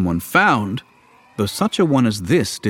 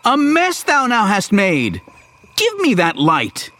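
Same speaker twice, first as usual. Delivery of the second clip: scolding; 9 semitones higher than usual. Acted. Luke scolds Yoda.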